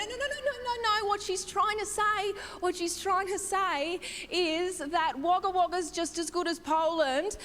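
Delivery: high-pitched rush of no-no-no; flustered interjection. Acted. Comedian as partner covering for mother-in-law, high flustered protest. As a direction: jump in high to smooth things over.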